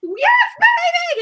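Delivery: put-on high, stretched-out screaming of baby; squealing fan shout from the crowd; fangirl yell. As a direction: scream it at your idol on stage. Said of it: "I was yelling".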